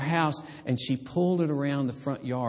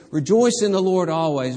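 louder, ringing call to rejoice; loud proclamation; 6 semitones higher, 8 dB louder than usual. Retold or imitated. Paul voiced to the church as a ringing loud call. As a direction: proclaim it to the rafters. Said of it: "It's as though Paul says".

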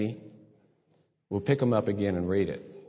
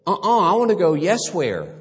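put-on loud, higher insistent little girl; insistent toddler; 8 semitones higher, 7 dB louder than usual. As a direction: demand it like a stubborn toddler. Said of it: "And she says".

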